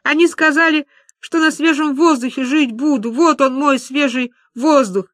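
acted tearful lament. Люба laments the false promises, tearful. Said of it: "И Люба снова зарыдала".

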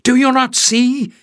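a hint of breathless discovery; narrator's Leif explaining the idea; excited realisation. A narrator's reading.

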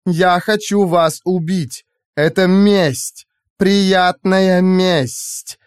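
high, very slow villainess; slow, gloating menace in a high voice; savouring revenge. A narrator's reading. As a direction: relish each word, drawn right out. AI savours announcing her revenge.

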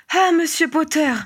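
high, effusive cry of greeting; exclaiming. A narrator's reading. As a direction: cry out on seeing your rescuer. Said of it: "s'écria Missus Wigglesworth". (exclamation aiguë et pleine d'effusion)

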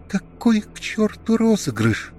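high, strained tearful retort; tearful struggle. A narrator's reading. Alena rejects Kirill's prank idea, high tearful strain. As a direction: force each word out through tears. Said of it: "каждое слово выговаривала с трудом".

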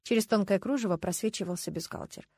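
ordinary delivery

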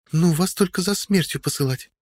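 put-on high-pitched grumbling young woman; grumbling girl. A narrator's reading. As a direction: grumble in a girl's voice. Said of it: "проворчала дежурившая у прохода Одри".

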